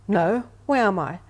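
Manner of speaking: loud, swooping retold reply; imitated bewildered lady. Confused lady answering the medium, loud imitated bewilderment. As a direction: answer loudly, acting out her confusion.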